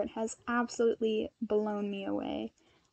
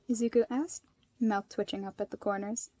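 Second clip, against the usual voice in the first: usual speaking voice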